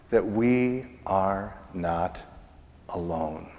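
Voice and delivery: gentle reassurance. Real, not acted. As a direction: say it like a comfort to someone lonely. Tender closing point, soft.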